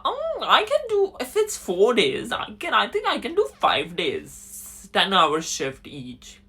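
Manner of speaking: high, loud mock-eager voice; eager offer to manager. Acting out his eager offer to manager.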